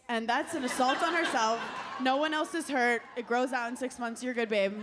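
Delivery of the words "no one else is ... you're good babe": ordinary delivery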